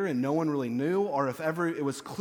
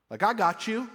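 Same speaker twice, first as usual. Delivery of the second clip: defiant; 5 semitones higher, 4 dB louder than usual. Acted. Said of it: "Is the demon bowing up?".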